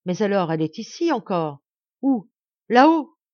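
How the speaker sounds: loud questions climbing to a cry; urgent questioning. A narrator's reading. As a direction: fire out the questions with rising urgency. Sudden hope of seeing her body. (questions pressantes, de plus en plus fortes)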